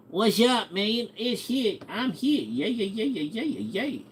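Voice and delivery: very high put-on voice, trailing into soft yeahs; party guest impression. Guest arriving at party, high silly voice. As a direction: squeak a greeting, then rattle off yeahs.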